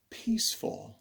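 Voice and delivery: usual speaking voice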